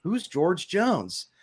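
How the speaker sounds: drawn-out, deadpan ask; slow mock question. Host quoting the movie's bar patron, slow and drawn out. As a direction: stretch each word out slowly. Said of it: "And he goes".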